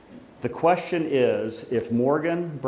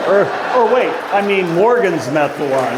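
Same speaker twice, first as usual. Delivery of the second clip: louder, laughing self-correction; excited laughing correction; 6 semitones higher, 8 dB louder than usual. Real, not acted. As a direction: catch the slip with a laugh. Corrects himself, guests laugh.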